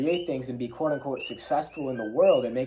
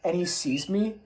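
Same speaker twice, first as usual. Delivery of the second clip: normal talking voice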